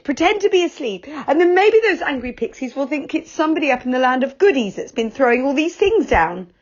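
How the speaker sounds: alarmed. A narrator's reading. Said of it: "said Joe in alarm".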